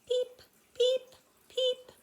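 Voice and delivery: very high, loud frog peeps; spring peeper call. Shown as a demonstration. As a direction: imitate a tiny frog with piercing short peeps. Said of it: "this is the spring peeper, and he says".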